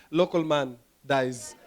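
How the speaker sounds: low, slow, subdued headline read; news headline reader. Acted. Comic voicing local news about himself, low headline read. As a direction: read it as a minor news item, softly. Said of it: "it'd just be like the headline".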